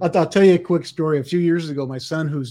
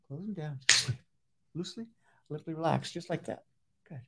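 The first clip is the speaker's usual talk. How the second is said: hypnotic induction; 3 dB quieter than usual. Acted. Hypnotist inducing second volunteer.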